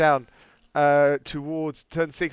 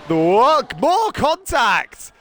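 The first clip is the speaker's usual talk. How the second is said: loud, slow excited exclamation; 8 semitones higher, 7 dB louder than usual. Real, not acted. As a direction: react to the crash loudly. Excited commentator reacting to crash.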